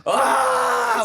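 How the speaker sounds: high, loud mock cry of delight; loud comic "ah"; excited yell. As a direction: cry out in hammed-up arousal. Retold excited reaction of the men.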